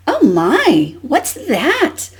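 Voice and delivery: wondering read-aloud question, pitch swooping up; mild storybook astonishment. Acted. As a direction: read Duck's puzzled question to children. Read-aloud of Duck's startled question.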